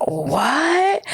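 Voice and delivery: high, rising incredulous "what"; mock disbelief. Viewer reacting to spoiled girl's complaint, high mock disbelief. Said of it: "you would be like".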